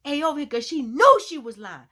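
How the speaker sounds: high, loud, furious accusation; angry yelling. Retold or imitated. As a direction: shout it after the girl walking away. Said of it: "So she started yelling".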